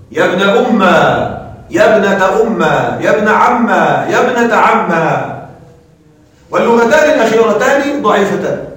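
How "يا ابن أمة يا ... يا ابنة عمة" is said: أمة and عمة said slowly, ending in a long a; ya turned to alif, slow. Shown as a demonstration. Teacher to students slowly showing the fourth form, ya turned to alif. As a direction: stretch the end into a long a. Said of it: "قلب الياء ألفا".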